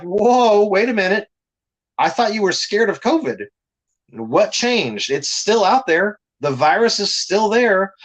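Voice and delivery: high-pitched, disbelieving objection; retold incredulous challenge. Fan confronts people who dropped their masks, mock incredulous.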